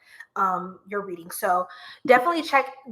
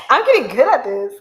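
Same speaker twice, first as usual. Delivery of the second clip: laughing; 8 semitones higher, 8 dB louder than usual. Real, not acted. Laughing at herself.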